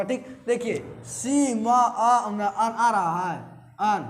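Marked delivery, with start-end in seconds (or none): 1.0-2.2 सी म आ slowly, each sound held apart; खींचकर उच्चारण. Shown as a demonstration. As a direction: draw out सी, then म, then a long आ. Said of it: "आपको इसमें उच्चारण करना होगा". (सी म आ एक-एक ध्वनि खींचकर)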